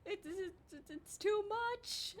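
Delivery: very high, pleading, drawn-out whine; program pleading. Pretend. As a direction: voice the overloaded program begging for mercy. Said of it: "the program is, like, screaming at me".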